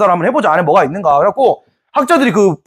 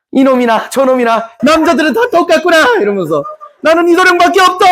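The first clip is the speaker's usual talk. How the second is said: indignant maiden; 9 semitones higher than usual. Acted. Chunhyang rejects inspector.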